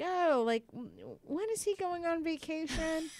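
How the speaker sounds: high, sweetly innocent little question; mock innocent. To the receptionist, faking innocence: a high sweet little question. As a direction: play it sugary and innocent to fool her.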